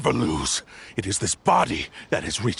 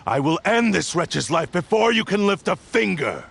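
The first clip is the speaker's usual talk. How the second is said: raised, higher threatening voice; menacing threat; 6 semitones higher than usual. Acted. Blade threatening its host's life, heated threat. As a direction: push the threat up with rising fury.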